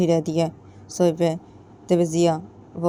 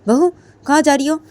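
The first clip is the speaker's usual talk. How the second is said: loud, high call after her; 10 semitones higher, 11 dB louder than usual. Acted. Mother-in-law calling after the leaving bride, loud and high.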